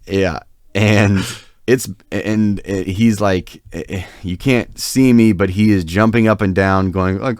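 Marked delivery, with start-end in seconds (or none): none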